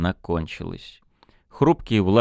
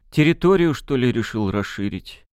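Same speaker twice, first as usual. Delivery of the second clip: puzzled guess; 4 semitones higher than usual. Acted. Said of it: "растерянно предположил Мурыгин".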